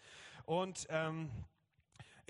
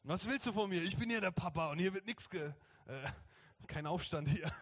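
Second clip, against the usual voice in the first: loud, raised-pitch papa outburst; mock yelling back; 5 semitones higher, 4 dB louder than usual. Father roars back at his small daughter, mock bellow. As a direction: play the father laying down the law. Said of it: "würde ich am liebsten zurückbrüllen".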